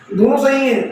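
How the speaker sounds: normal talking voice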